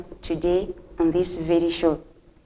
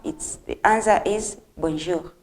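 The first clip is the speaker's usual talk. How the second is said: usual speaking voice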